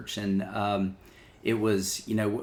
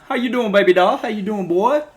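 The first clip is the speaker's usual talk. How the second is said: high, loud Southern country twang; country twang impression; 12 semitones higher, 11 dB louder than usual. Pastor imitating his lead pastor's country twang greeting. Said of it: "I can do a perfect impression".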